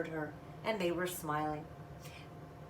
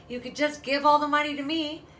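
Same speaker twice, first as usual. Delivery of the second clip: higher, louder scheming offer; sly sister; 5 semitones higher, 7 dB louder than usual. Acted. Big sister scheming for the money, sly. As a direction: sweet-talk your little sister out of her money.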